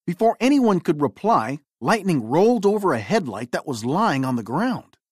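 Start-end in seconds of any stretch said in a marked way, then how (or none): none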